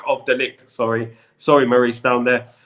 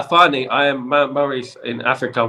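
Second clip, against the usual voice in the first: normal talking voice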